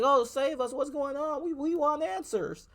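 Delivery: very high, pleading mock voice; high mocking whine. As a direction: squeak it out like a helpless citizen. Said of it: "You're like".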